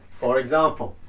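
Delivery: ordinary talk, nothing marked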